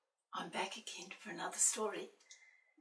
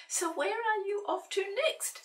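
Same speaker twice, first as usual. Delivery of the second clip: playful question; 12 semitones higher, 7 dB louder than usual. A narrator's reading. Asking the cat playfully.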